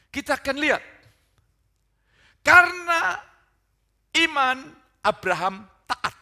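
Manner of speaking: loud, high pulpit proclamation; preaching at full force. Real, not acted. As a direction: proclaim the verse at full volume, high above normal talk. (khotbah berapi-api dengan suara lantang)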